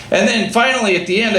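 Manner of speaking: ordinary delivery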